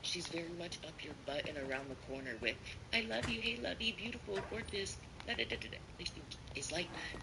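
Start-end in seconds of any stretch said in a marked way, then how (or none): 2.9-5.8 high, gushing sweetness, mocking; sugary Laura voice. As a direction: pile on the pet names like she does. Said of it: "overdoes it with the sweetness".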